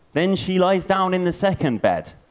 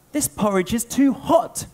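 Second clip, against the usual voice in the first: Goldilocks complaining; 9 semitones higher than usual. Acted. Goldilocks voiced in told story.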